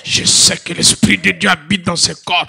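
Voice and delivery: loud chanted prayer over music; preaching at full force. Acted. Preacher resisting sexual immorality, shouted sung-out prayer.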